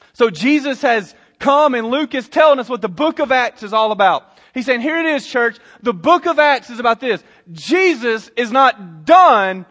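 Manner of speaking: loud, punched preacher's declaration; impassioned sermon climax; emphatic preaching. Real, not acted. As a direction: land the key words hard for the church. Preaches the book's theme.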